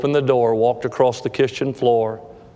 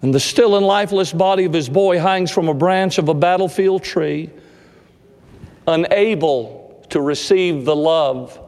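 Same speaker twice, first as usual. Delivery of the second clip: fervent sermon cadence, slowed; preaching; 4 semitones higher than usual. Acted. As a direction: preach it out, drawn and lifted. Preaches the story's climax.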